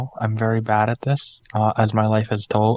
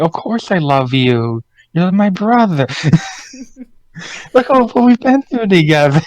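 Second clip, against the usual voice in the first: oblivious Emma; 7 semitones higher, 3 dB louder than usual. Retold or imitated. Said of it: "Emma just kinda being like".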